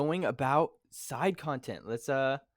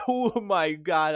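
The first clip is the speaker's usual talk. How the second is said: amazed gush; 4 semitones higher, 7 dB louder, much slower than usual. Retold or imitated. Imagined fan thrilled at plot twist.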